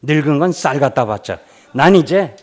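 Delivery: loud angry rant; mock husband's furious yelling; angry shout. Husband yelling at his wife over offerings, mock angry shout. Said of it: "막 화를 냈어".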